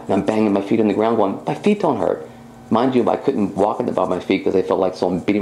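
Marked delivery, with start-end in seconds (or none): 1.5-2.3 high, thrilled disbelief; amazed joy. Retold or imitated. Woman testing healed feet on the floor, thrilled. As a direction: sound like a miracle just happened.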